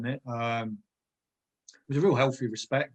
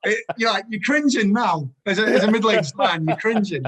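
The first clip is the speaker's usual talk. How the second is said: laughing cringe; 7 semitones higher, 8 dB louder than usual. Real, not acted. Laughing embarrassment at his past.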